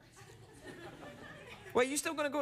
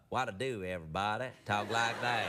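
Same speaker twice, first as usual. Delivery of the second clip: low-pitched mock small-town American; American regional accent; 11 semitones lower than usual. Shown as a demonstration. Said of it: "do the accent from that town".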